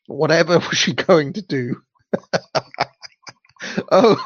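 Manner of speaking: giggling through the line; can't hold back a laugh; real giggle mid-sentence. Real, not acted. Narrator breaks into laughter.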